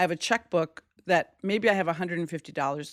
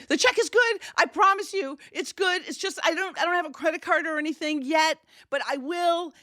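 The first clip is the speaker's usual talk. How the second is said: desperate pleading; 10 semitones higher than usual. Retold or imitated. To the tow man over the phone, high desperate promise. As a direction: plead as if everything hangs on it. Desperate to get him to say yes.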